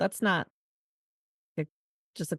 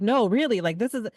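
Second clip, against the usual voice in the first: raised, pleading no really, pushing back hard; retold insistent protest; 5 semitones higher, 4 dB louder than usual. A guest protesting to the dad who says forget the door, urgently raised. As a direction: insist louder and higher, refusing his kindness. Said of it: "And I was like".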